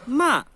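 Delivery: "ma" high and loud, dropping fast; forceful falling Mandarin fourth tone on "ma". Shown as a demonstration. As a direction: throw "ma" down sharply from a high start. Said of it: "A gente teria ma".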